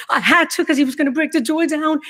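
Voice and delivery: high, upset rush to the police; frantic plea. Retold or imitated. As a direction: relive the panic of explaining. Answering police question, upset.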